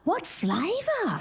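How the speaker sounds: small, high, slow voice from the back; little voice. Retold or imitated. Audience member asking the comedian, small high voice. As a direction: pipe it up meekly from the back row. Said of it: "this little voice go".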